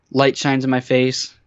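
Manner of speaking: ordinary delivery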